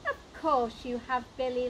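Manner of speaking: plain reading voice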